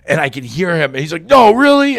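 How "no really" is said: high, loud incredulous question; mock-appalled, pitch shooting up; shocked disbelief. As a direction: act out the friend's loud shock. Retold friend's shocked disbelief.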